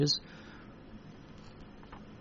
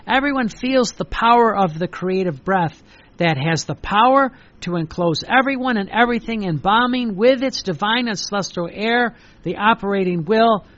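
louder, swelling devotional reading; fervent reading; 4 dB louder than usual. Acted. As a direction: proclaim the divine words with fervour. Reading the revelation aloud.

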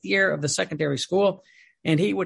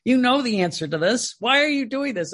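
exasperated priest; 6 semitones higher than usual. Retold or imitated. Retold priest scolding.

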